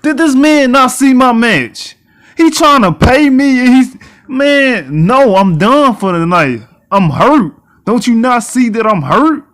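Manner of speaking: loud, high angry complaint; indignant rant. Real, not acted. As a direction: vent at the GM's nerve. Ranting at GM offer.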